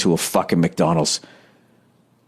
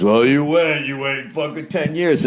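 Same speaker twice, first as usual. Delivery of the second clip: put-on voice, pitched higher; mocking know-it-all parents; 5 semitones higher than usual. Comic imitates other parents warning him, mocking put-on voice. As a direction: sneer it like a warning from a jaded parent. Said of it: "all the parents".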